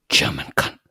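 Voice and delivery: whispered from the dark. Retold or imitated. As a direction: breathe it like a hidden captive. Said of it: "they hear someone whisper".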